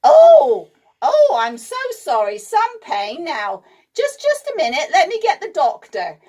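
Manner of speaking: high, flustered apology; flustered nurse. A narrator's reading. Nurse apologising to the boy, flustered. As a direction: gush sorry in a fluttery voice.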